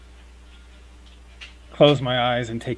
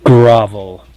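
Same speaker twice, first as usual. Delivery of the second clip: loud, lower command; commanding spell word; 3 semitones lower, 12 dB louder than usual. Acted. Cleric casts a spell on the man who smacked her, loud command. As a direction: boom it out as an order.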